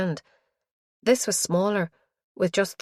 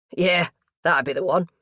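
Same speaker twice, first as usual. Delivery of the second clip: put-on easy London-accented comeback; strong London accent; 4 dB louder than usual. A narrator's reading. Mortuary lad teasing the detective, strong London accent. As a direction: confirm it in broad London vowels. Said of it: "he said in a strong London accent".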